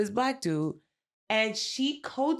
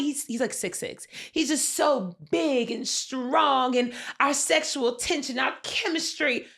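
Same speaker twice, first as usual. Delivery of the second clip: higher, breathy-sounding mock lust; mock lustful swooning; 7 semitones higher than usual. Friend mocking a contestant lusting over Corbin, higher. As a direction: ham up a smitten contestant. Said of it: "the way she talks about Corbin".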